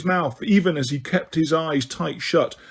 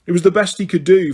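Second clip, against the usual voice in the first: ordinary delivery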